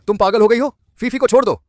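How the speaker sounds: high, loud panicked yelling; alarmed shout. Acted. Husband shouting at wife to release Fifi, alarmed. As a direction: yell at her to let go.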